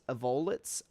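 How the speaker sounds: "Avolites" as uh-VOH-luts, rising; middle-stressed variant. Shown as a demonstration. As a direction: try "Avolites" stressed on VOH, last syllable reduced. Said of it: "four different ways that you can".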